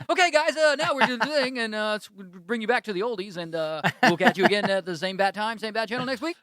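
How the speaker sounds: over-the-top radio host send-off; announcer voice. Retold or imitated. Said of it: "Should do that as anyone leaves".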